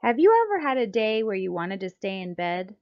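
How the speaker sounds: ordinary delivery, nothing marked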